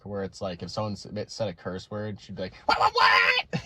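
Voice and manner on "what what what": high shrieking parrot-like shout; squawking bus driver. Retold or imitated. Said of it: "reminds me of the bus driver from South Park".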